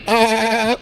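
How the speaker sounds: high, feeble mock groan of a near-dead goat; mock weak goat moan; dying goat groan. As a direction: bleat it out like it's almost dead. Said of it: "they're like".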